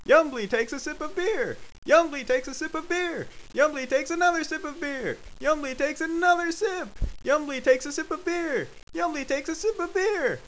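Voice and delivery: high-pitched, relentless narration; frantic escalation. A narrator's reading. Narrator counts Yumbly's endless sips, frantic and high. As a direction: recite it like a siren winding up.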